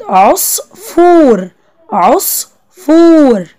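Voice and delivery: عصفور very slow, split as "os-fooor"; slow dictation of عصفور, pause then long "fooor"; drawn-out syllables in عصفور. Shown as a demonstration. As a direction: hold the oo of عصفور long for pupils. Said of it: "الممدود هو اللي ناقص".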